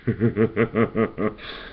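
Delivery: lightly done villain cackle; a hint of a Joker cackle after the punchline; Joker laugh. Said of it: "he goes".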